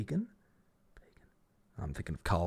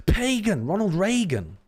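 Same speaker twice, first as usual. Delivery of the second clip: higher and louder, triumphant discovery; excited eureka; 10 semitones higher, 7 dB louder than usual. Real, not acted. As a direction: shout out the answer as it clicks. Solves the puzzle link.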